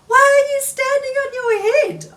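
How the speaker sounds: a small frog calling out in surprise; high Frog voice. Acted. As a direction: pitch it way up for a puzzled Frog. Frog voiced in read-aloud story.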